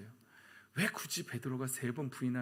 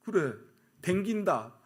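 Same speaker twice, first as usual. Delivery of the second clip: loud, higher defiant retort; bold; 5 semitones higher, 8 dB louder, slower than usual. Acted. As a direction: own up boldly, unashamed. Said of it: "담대하면 되는 거예요". (담대하고 큰 소리로 맞받아치기)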